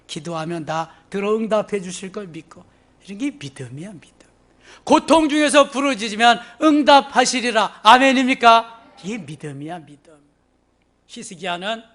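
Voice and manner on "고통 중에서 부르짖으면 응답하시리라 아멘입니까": loud, raised-pitch proclamation; fervent preaching. Real, not acted. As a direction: preach it out and demand an amen. Preaches at full force. (힘차게 외치는 설교)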